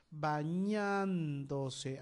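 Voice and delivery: Bañándose very slowly, ba-ÑAN-do-se; stressed syllable drawn out. Shown as a demonstration. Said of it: "ahí tienes la sílaba tónica".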